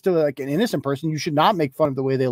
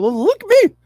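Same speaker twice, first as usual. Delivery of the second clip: very high, excited mocking squeal; hammed-up falsetto bragging, begging for praise; mock attention-seeker; 4 dB louder than usual. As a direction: squeal for attention like a showy activist. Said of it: "Just one performative thing".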